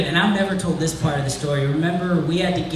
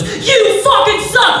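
high, loud, slow scream of an insult; furious yell; 8 dB louder, slower than usual. Retold or imitated. Kid grabs the mic and screams at the comic. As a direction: blast it into the mic. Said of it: "rips the microphone out and goes".

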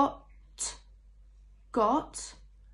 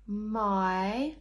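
ordinary talk

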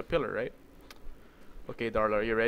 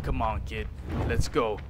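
ordinary talk

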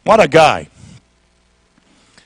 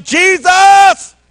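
high, full-voiced shout; crying out; 10 semitones higher than usual. Blind man calling out to Jesus, high shout. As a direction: cry out high for attention. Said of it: "He starts to cry out".

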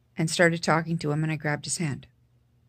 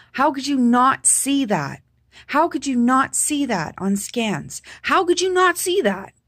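angry outburst; 8 semitones higher, 6 dB louder than usual. Retold or imitated. Confronting the doctor over the missed scans, angry pleading shout. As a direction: demand it, voice climbing in disbelief. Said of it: "I was angry".

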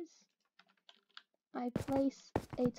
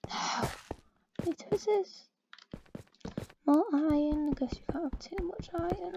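usual speaking voice

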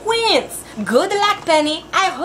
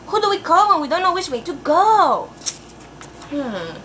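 ordinary delivery

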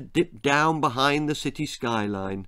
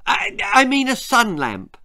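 hurried; 9 semitones higher, 5 dB louder than usual. Acted. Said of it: "said Willard hurriedly".

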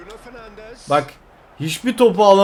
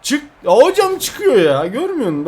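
indignant protest; 6 semitones higher than usual. Real, not acted. Gamer tells the referee it went out, indignant. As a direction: protest the call, pitch jumping up.

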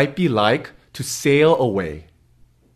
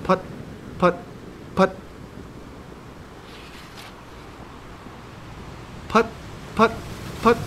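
puttering motor; 7 semitones higher, 11 dB quieter, faster than usual. Acted. Boat motor sound effect.

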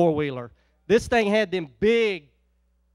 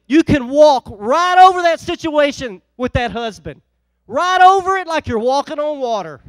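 louder, higher climax of the sermon; rising exhortation; 5 semitones higher, 10 dB louder than usual. Acted. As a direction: build it up, lift the crowd. Preacher builds to climax.

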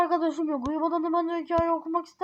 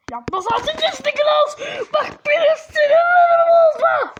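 put-on furious, high-pitched shouting at a child; angry parent yelling in play; angry scolding; 11 semitones higher, 10 dB louder than usual. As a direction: shout it loud and high, losing your temper. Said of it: "ben niye bu bölüm sinirlendim ya".

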